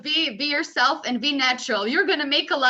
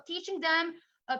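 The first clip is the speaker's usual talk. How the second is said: ordinary delivery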